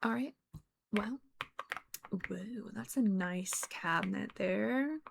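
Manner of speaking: slow sneaky whisper. Real, not acted. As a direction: hush it while snooping. Sneaking around the house.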